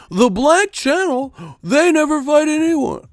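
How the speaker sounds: high, loud mocking pundit voice; mock pundit whine. Mocking old media dodging the question, high whine. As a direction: whine it like a clueless talking head.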